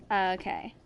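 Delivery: ordinary delivery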